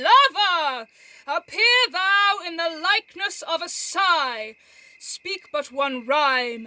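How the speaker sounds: mocking shout to a hidden friend; mock incantation shout. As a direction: summon him like a showman magician. Mercutio mock-conjures Romeo.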